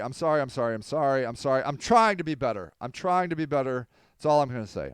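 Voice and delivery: ordinary talk, nothing marked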